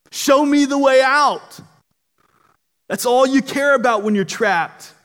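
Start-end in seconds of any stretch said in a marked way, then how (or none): 0.1-1.6 loud, high demand to be led out; urgent cry. Acted. Someone trapped in a cave calls for rescue, urgent cry.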